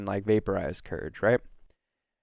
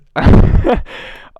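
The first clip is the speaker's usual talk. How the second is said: loud laugh; 12 semitones higher, 12 dB louder than usual. Real, not acted. Bursts out laughing mid-rant.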